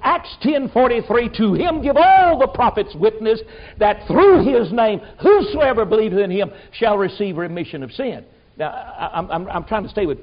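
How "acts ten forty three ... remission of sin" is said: fervent preaching. Real, not acted. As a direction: proclaim it from the pulpit, drawing it out. Preaching at full force, scripture.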